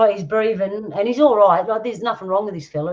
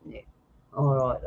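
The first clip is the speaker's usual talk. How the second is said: usual speaking voice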